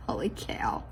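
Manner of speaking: whispered disbelief; an amazed unvoiced whisper to herself. Real, not acted. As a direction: breathe it out in quiet shock. Disbelief at own mistake.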